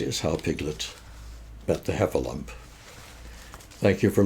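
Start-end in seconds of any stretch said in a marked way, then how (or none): none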